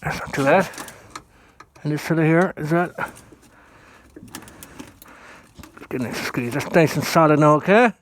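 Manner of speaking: talking through a phone gripped in the teeth; phone held in teeth. Real, not acted. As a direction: mouth full, words around the phone. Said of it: "I take that out of my mouth".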